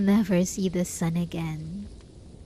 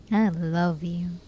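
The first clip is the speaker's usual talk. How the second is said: ordinary delivery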